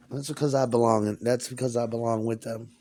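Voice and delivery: ordinary talk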